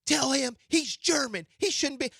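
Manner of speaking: high, loud mock-angry caller. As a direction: bark it like an outraged listener. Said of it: "He said".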